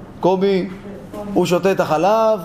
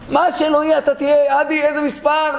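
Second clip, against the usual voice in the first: acted wife pressing, pitched high; insistent questioning; 7 semitones higher than usual. Wife quizzing her husband on his party rank, insistent. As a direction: drill him with raised, insistent questions.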